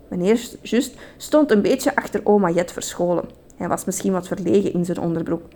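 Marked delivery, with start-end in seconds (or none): none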